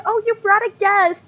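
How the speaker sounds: put-on high, eager girlish voice; excited squealing. Girls noticing the guest he brought, excited squealing. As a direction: coo in high-pitched delight. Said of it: "a bunch of excited squealing".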